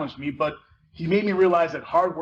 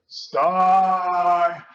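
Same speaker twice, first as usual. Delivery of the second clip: calling out; 6 dB louder than usual. Acted. Khalil calls her across the party.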